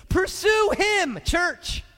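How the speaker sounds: high, loud, stretched-out shouts; full-force preaching. Real, not acted. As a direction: urge the congregation on at full voice.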